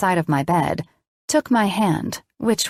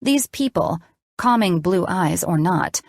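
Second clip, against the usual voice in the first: ordinary delivery, nothing marked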